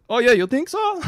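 put-on high, loud Italian-accented old man. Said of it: "got an Italian accent".

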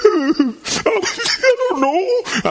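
very high, drawn-out comic wail; exaggerated tearful whine, stretched out slowly; hammed-up helpless crying; mock wailing. Retells tearful helpless reply to son.

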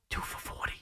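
stunned whisper. Real, not acted. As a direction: breathe it out in disbelief. Whispered repeat of the stat.

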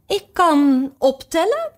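high, slow, out-of-breath queen voice; fussy old queen. Acted. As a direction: gasp it out, eyes closed. (hijgende, hoge koninginnenstem)

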